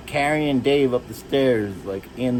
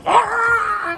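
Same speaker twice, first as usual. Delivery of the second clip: sudden high, loud yell; freak-out scream; 7 dB louder than usual. Retold or imitated. Imitating Dave freaking out when touched, screaming. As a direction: burst into a piercing "Ah!". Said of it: "he would go".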